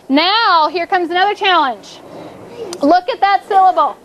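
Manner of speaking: ordinary talk, nothing marked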